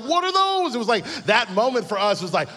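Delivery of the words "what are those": high, loud what are those; shocked outcry. Retold or imitated. Sneakerheads spot Crocs in the mall, shrieking in shock. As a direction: squeal in disbelief at the shoes. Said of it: "We were like".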